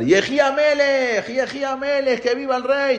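high, sustained acclamation; crowd shouting. Acted. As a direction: cry it out high like a cheering crowd. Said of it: "todos empezaron a gritar".

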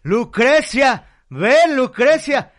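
loud, high, slow calling out; old man calling his wife to the room, loud and drawn out; excited yelling. Acted. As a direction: shout for your wife, thrilled. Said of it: "se emociona y empieza así de".

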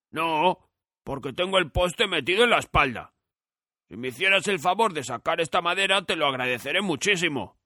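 hopeful, polite plea; pleading scarecrow. A narrator's reading. Scarecrow asks Dorothy to pull out the pole.

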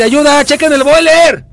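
high yell building to a shout; shouting for help. Acted. No hot water, calls for help.

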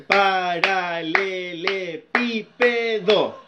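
"Paralelepípedo" very slowly, split into syllables; drawn-out "Paralelepípedo", one clap-beat per syllable. Shown as a demonstration. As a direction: count out every syllable of "Paralelepípedo". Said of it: "a contar las sílabas".

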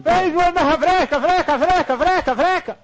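loud, high market-stall call; market hawker cry. Pretend. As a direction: shout it like a fishmonger selling clams. Pregón de vendedor. (pregón de pescadero a voz en grito)

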